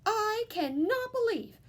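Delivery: high, loud scolding mother; a high mother scolding her son; scolding mama. A narrator's reading. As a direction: scold him for the walls. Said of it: "she scolded".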